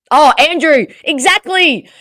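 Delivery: loud, high exclamation of agreement; emphatic agreement. Real, not acted. Streamer replying to a viewer's comment, emphatic loud agreement.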